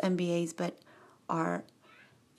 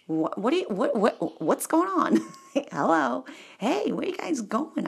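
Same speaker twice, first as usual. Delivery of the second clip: stammering, higher-pitched curiosity; excited curiosity; 6 semitones higher than usual. Acted. Onlooker calls to four boys in ties, excited. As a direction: call out to them, eagerly intrigued.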